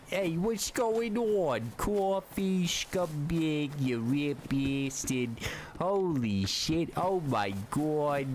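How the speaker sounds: high, slow, exaggerated New York accent; hammed-up New York loudmouth accent, drawn out and high; over-the-top New York accent. Shown as a demonstration. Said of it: "my version of a New York accent".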